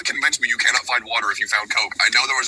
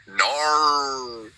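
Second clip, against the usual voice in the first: higher, drawn-out Aussie "Nar" for "no"; exaggerated Australian accent; 6 semitones higher than usual. Shown as a demonstration. Showing how Australians differ from Kiwis, exaggerated Australian accent. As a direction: stretch "Nar" like the start of narwhal. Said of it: "Australians go".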